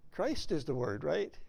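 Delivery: ordinary talk, nothing marked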